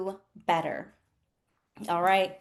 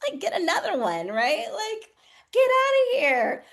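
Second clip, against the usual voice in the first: dismissive high exclaim; 8 semitones higher, 5 dB louder than usual. Retold or imitated. Telling a friend who lost her job, high scoffing dismissal. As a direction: push it up high and loud, stretched out. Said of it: "And I'm like".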